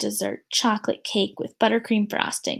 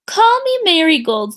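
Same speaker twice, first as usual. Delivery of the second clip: higher, louder eager plea; eager kid; 6 semitones higher, 7 dB louder than usual. Acted. Jo asking to be called Marigold, eager. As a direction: want in on it. Child wants flower name.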